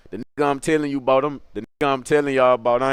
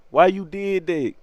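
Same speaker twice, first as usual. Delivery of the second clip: slow, higher-pitched wounded question; mock hurt; 4 semitones higher, slower than usual. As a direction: play the wronged victim, drawn out. Acts hurt reaction to the punch.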